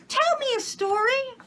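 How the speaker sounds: put-on very high child asking; little girl's voice. A narrator's reading. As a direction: plead like a small child. Child asks for a story.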